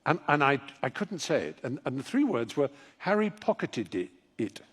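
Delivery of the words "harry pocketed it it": "pocketed it" tripping into repeated d-d syllables; tangled tongue on "pocketed it"; tongue-tied stumble. Shown as a demonstration. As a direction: stutter extra "did" sounds into "pocketed it". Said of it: "See, I still can't say it".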